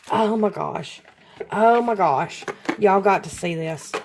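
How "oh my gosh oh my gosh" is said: voiced, amazed exclamation; excited. Real, not acted. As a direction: let the wonder out aloud. Gasping at unboxed gift.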